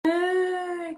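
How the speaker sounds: high, drawn-out mock cry; imitated child's wail. Mother imitates her son fussing in the chair, high wail. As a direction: whine like a fussy baby, one long note. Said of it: "De repente ele ficou".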